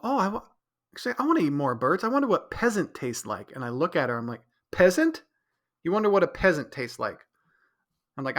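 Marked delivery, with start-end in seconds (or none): 0.0-3.5 higher, sing-song imitation of her; mock girlfriend voice. Quoting his girlfriend musing in a high mock voice. Girlfriend quoted wanting birds. 4.7-7.2 high, loud disbelieving question; incredulous. Retold or imitated. Repeating his girlfriend's word back in loud disbelief.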